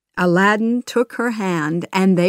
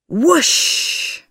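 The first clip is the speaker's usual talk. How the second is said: whooshing sound; 9 semitones higher, 4 dB louder than usual. A narrator's reading. Genie magic carrying them away.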